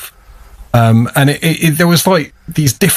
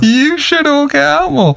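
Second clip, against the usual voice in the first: mock speech line. Mocking repeat of the speech line.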